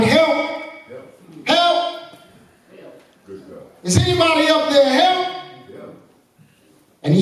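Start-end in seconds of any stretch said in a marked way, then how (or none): 0.0-5.3 calling for help. A narrator's reading. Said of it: "He cried".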